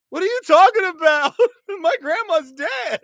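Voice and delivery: very high-pitched, amused disbelief; retold high-voiced protest; indignant mock protest. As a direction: squeal it like an offended boy, half laughing. Teammates' shocked reply, retold through laughter.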